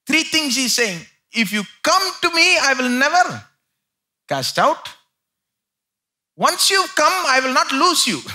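loud, high preaching, hammering the promise; full-force preaching. Acted.

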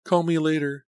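high, breathless brush-off. A narrator's reading. Laura hurrying to end the call, breathless. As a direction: rush her off the phone breathlessly. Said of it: "she said breathlessly".

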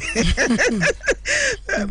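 high burst of laughter. Real, not acted. Host laughs on air.

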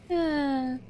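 ordinary talk

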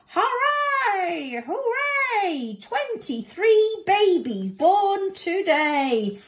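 high, loud, drawn-out cheer; joyful cry. Acted. Mother crocodile celebrates her babies, jubilant cry. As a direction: cry it out in triumph, stretching the words. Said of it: "and cried out".

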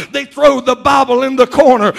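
normal talking voice

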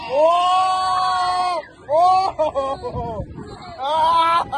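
high excited cries; thrilled gasps. Real, not acted. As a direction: yelp as the ride speeds up. Thrill exclamations on fast ride.

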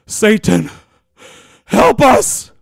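high, loud frantic plea from someone overwhelmed; a frightened high shout begging for help; desperate cry for help. Retold or imitated. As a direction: cry out to Satan in panic, pitched high and loud. The Lord's desperate plea retold.

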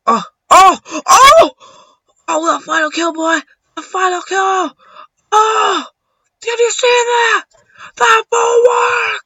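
very high, stretched-out yells; excited screaming. Real, not acted. Gamer celebrating the final kill, high-pitched excited shouting. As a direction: shriek in triumph, dragging out the last words.

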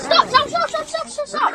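high frantic stop stop stop; frantic high pleading. Real, not acted. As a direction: beg them to stop, panicking. Panicked pleading in game.

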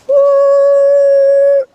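high, loud, hooting whistle cry; train whistle woo. A narrator's reading. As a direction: blast it out like a steam whistle. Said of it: "a train whistle blew".

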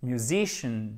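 "musicians" ending in a released z; audible final Z. Shown as a demonstration. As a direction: let a little "zz" sound after "musicians". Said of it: "on entend un petit the à la fin". (« musicians » avec un petit « z » final)

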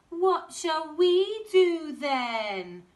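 high, loud whining slowly; slow whiny kid's voice; whiny child. Acted. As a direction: whine like a bored little girl.

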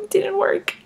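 ordinary talk, nothing marked

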